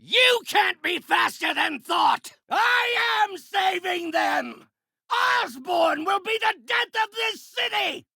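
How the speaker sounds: forceful declamatory battle shout; grandiose, furious proclamation; raging villain. Acted. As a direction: shout it as a righteous madman.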